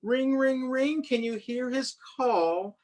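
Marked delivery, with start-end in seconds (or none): none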